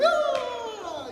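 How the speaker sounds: long high-pitched loud mock shriek; mock scream. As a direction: scream in exaggerated fright, held long. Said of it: "and you go".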